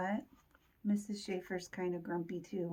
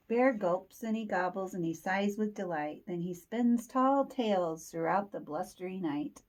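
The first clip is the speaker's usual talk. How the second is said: ordinary delivery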